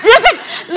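sudden high loud laugh; high burst of laughter. Real, not acted. Laughs recalling the sip.

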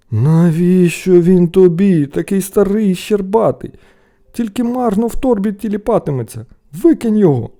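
high, loud exasperation; scornful scolding. A narrator's reading. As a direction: berate your foolish brother. Brothers scorn the find.

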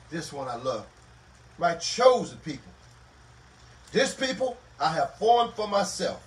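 loud, booming scripture declaration; preaching at full force. Acted. Preacher to grieving congregation, proclaiming God's promise at full force.

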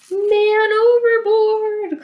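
drawn-out loud high holler; calling out. Acted. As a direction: stretch out the call across the water. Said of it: "called Billy Bob".

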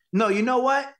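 high, insistent urging; impulsive director. Retold or imitated. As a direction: imitate a director abruptly changing course. Director abruptly overrules the actor's suggestion.

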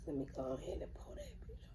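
breathy ASMR whisper while pouring; soft whisper as if to the microphone. Acted. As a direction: hush it down for the mic. ASMR video, pouring honey.

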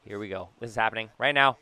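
ordinary talk, nothing marked

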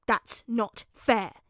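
slow, loud, punched-out protest; snarling. A narrator's reading. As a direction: hit each word hard in a child's outrage. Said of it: "he snarled".

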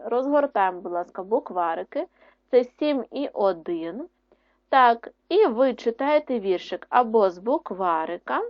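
normal talking voice

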